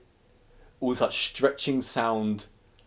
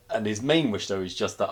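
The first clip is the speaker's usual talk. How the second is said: usual speaking voice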